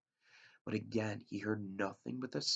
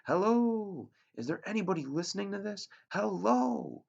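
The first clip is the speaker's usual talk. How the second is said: high, loud desperate calls; frantic raised-voice hello; calling out; 11 semitones higher, 8 dB louder than usual. Acted. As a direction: shout into the radio hoping someone answers. Boy calling on plane radio.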